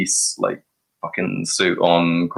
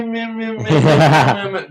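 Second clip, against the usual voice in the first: mocking blah blah; 8 semitones higher, 4 dB louder than usual. Mocking blah-blah of own pitch.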